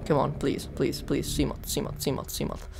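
soft, repetitive pleading under pressure; hushed desperate begging; urgent pleading. Real, not acted. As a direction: plead quietly, repeating each word like a chant. Pleading while about to drown.

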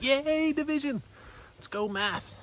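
high-pitched, over-excited mock cheer; mock pumped cheer. Preacher imitating churchgoers pumped about long division, mock excited. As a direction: cheer it like a hammed-up math fan. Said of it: "you'd be all pumped".

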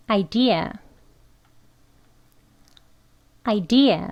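idea said with the long ee; word drill. Shown as a demonstration. Teacher models idea twice for learners, careful drill. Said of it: "발음 두 번 같이 해볼게요".

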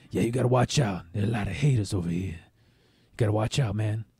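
low, quiet warning from an imitated coworker; lightly voiced pimp, low and soft; Pinky. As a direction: mimic a coworker's hushed heads-up. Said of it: "he sounds exactly like him".

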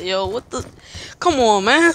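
ordinary talk, nothing marked

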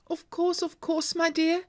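high, eager cry of delight; a husband gushing yes to his wife; high excited exclamation; cried out. A narrator's reading. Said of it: "Maguire cried".

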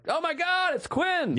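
high-pitched starstruck squeal in imitation; excited fan. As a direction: mimic a fan spotting a celebrity. Voicing fans spotting a celebrity.

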